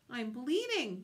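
yelp. A narrator's reading. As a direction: yelp it, moderately acted. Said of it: "the fox yelped".

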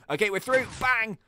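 usual speaking voice

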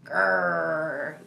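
low playful bear growl; deep throaty growls. Pretend. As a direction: growl like a grizzly for kids. Playing the grizzly bear.

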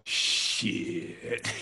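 low whispered appreciative curse. Retold or imitated. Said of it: "then he goes like this".

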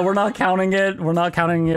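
usual speaking voice

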